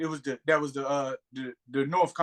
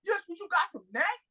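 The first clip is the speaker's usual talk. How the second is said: high, disbelieving squeak of a question; can't-believe-it high question; incredulous; 13 semitones higher than usual. Real, not acted. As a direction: pitch it way up in disbelief. Incredulous at the movie line.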